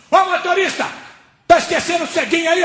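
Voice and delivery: high-pitched call across the bus; passenger's shout. Retold or imitated. Man at the back alerts the driver, high shout.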